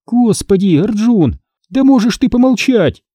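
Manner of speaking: high-pitched weary complaint; exasperated near-groan. A narrator's reading. Hannah to Arjun telling scary rumours, almost moaning. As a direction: plead for silence, high and fed up. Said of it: "чуть не простонала Ханна".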